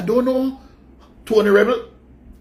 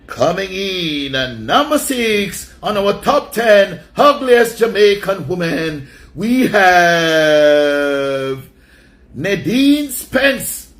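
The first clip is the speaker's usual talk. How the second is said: drawn-out louder host voice counting down the list; countdown announcer; 4 dB louder, much slower than usual. Acted. Host announcing the next entry on his list, slow and booming. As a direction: announce it like a chart show host, stretching the words.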